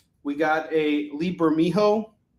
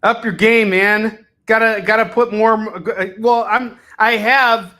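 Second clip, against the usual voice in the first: loud pep talk; 3 semitones higher, 10 dB louder than usual. Real, not acted. Urging himself on loudly.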